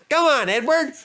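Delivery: eager, impatient urging. Acted. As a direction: James urging Edward to hurry. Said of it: "urged James".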